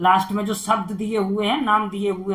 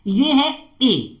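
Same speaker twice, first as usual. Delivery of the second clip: ए drawn out slowly; slow vowel demo; 3 semitones higher, much slower than usual. Shown as a demonstration. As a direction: stretch ए out slow for the class. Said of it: "इसका sound होता है ए". (धीमे खींचकर ए)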